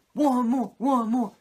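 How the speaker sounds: high, loud hammed-up crowd chant; mock cheering extras. Mocking Baywatch extras cheering Savage on, high and loud. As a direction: pump him up like beach extras, high and loud.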